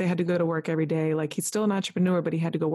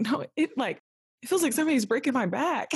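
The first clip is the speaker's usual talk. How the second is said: higher, exasperated retold complaint; pained insistence; 6 semitones higher than usual. Labouring patient tells staff her back hurts, hammed-up desperation. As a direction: insist in hammed-up agony, pitch raised.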